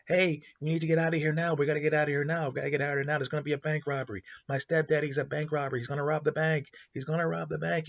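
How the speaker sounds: put-on high frantic child voice, urgent; a panicked eight-year-old yelling a warning; shouting kid. Kid warning the bank staff about the robbery, high frantic shout. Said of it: "And he's shouting this".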